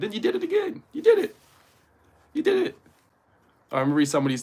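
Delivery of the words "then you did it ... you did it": very high, louder falsetto squeal; squealed mock accusation. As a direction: accuse them in an incredulous squeak. Mock-scolding viewers who broke their promise.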